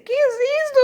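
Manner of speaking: very high, loud little mouse; tiny high-pitched storybook mouse. Acted. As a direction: squeak it out like a tiny puzzled mouse. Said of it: "El ratoncito dice".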